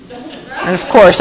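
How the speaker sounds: ordinary talk, nothing marked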